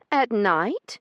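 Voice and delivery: high, loud questioning lady's voice rising; Io's repeat. Acted. Io echoing Branson's demand, high questioning rise. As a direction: repeat it back in disbelief. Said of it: "she repeated flatly".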